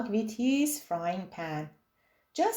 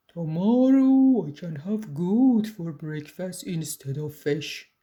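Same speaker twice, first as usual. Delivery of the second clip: dreamy troll voice, lower; a troll drifting toward sleep; sleepy troll daydreaming of breakfast; troll thinking aloud; 3 semitones lower than usual. A narrator's reading. Troll musing.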